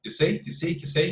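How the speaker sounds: usual speaking voice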